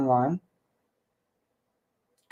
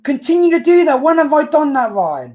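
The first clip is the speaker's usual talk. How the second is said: raised, high-pitched objection; indignant protest; 7 semitones higher, 5 dB louder than usual. Real, not acted. Co-host denies doing what he's accused of, indignant protest. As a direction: protest loudly at an unfair accusation.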